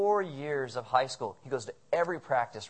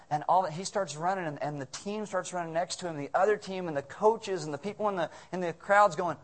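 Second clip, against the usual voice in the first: ordinary delivery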